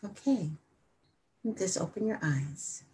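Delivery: very soft and unhurried; gentle hush. Real, not acted. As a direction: ease them out of silence quietly. Gently ends the silent meditation.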